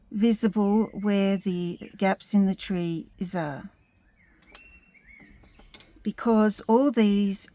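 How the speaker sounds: usual speaking voice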